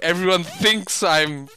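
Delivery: normal talking voice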